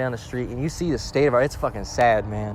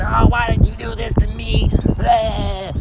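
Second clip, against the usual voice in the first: high, loud mock wail; exaggerated screamed lament; mock screaming; 10 semitones higher, 6 dB louder, slower than usual. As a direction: wail it like a hammed-up tantrum. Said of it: "You're gonna be screaming".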